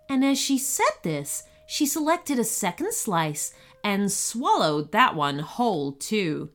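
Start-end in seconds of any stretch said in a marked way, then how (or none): none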